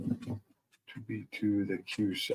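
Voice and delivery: slow, quiet, halting read; hesitant reading. Real, not acted. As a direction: puzzle it out slowly under your voice. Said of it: "Took me a second to read that right".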